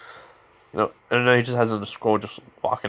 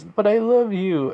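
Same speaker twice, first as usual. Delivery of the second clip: mock pleading; 10 semitones higher, slower than usual. Pleading against a divorce.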